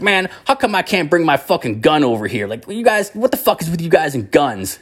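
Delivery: high-pitched indignant griping; acting out an aggrieved relative's rant; imitated bitching. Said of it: "the one guy was bitching".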